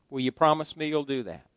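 ordinary delivery

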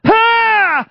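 sudden high, loud scream; piercing acted outburst; imitated scream. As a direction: yell out as the panicking passenger. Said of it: "he'd just break out and scream".

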